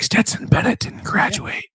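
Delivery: voiceless, conspiratorial whisper; mock secret whisper. TV character sharing a secret in the kitchen, mock whisper. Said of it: "things that people are whispering".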